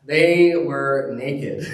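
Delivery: very slow, stressed "they were naked"; echoing the reader, slow and heavy on "naked"; mock solemn emphasis. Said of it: "the way that story is meant to be read".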